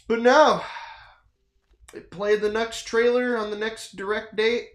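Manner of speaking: usual speaking voice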